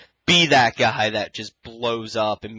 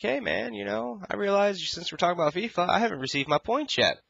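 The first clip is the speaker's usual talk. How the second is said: much higher, light friendly voice; retold casual chat; 10 semitones higher than usual. Telling his friend Wolf, a high breezy aside. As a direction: toss it to your buddy like you just remembered. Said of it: "and I'm like".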